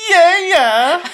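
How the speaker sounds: high-pitched, loud shouted hype. Acted. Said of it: "he does a great Little John".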